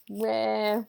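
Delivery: mock-pouty little grumble; imitating a pouting child. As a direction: grumble it like a sulky kid. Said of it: "all just pouty and kinda like".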